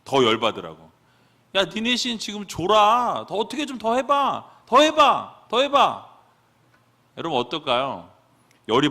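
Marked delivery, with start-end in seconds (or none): 1.5-6.1 high, loud goading jeer; goading taunt. Retold or imitated. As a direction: needle them to make them angrier. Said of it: "팍팍 긁는 거예요. 더 열받으라고".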